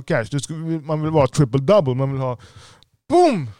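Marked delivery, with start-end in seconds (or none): 3.1-3.5 a sudden high, loud burst; excited boom. Real, not acted. Pumping up listeners about a top seller, loud excited boom. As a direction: shout the boom like an explosion of success. Hyping a salesman's big results.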